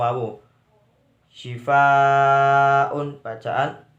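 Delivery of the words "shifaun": "Shifaun" with a very long, loud, level aa; mad wajib muttasil. Shown as a demonstration. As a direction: hold the "fa" of "Shifaun" long before the un. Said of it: "mad wajib muttasil". (baca "Shifaun" dengan fa dipanjangkan sekali)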